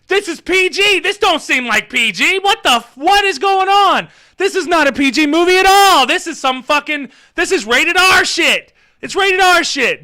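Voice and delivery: high, loud, incredulous ranting; mock outrage. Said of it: "sitting here like".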